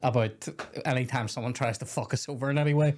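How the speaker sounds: usual speaking voice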